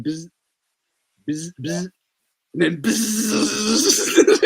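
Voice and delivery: slow drawn-out buzzing bee noise. Shown as a demonstration. Buzzing like a bee to mock chatty coworkers. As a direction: buzz like a busy bee.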